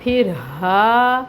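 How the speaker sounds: slow, airy ہا from the throat. Shown as a demonstration. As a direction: push ہا out from the back of the throat. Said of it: "اقصاء الحلق سے نکلتا ہے".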